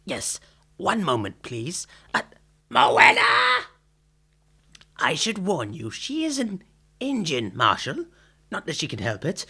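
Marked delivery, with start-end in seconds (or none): 2.7-3.6 loud call to the back room. Acted. Tailor calling his assistant from upstairs, loud call. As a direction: shout her name across the shop.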